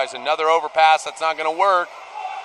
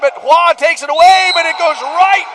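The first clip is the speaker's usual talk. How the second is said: much higher and louder, racing to the finish; excited goal call; 7 semitones higher, 4 dB louder than usual. Real, not acted. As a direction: ride the play all the way into the net. Live call of a goal sequence.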